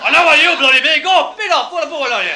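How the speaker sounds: high, loud police yell; furious high-pitched shouting; angry yell. Retold or imitated. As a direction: bellow it at the culprit. Acted police yelling at him.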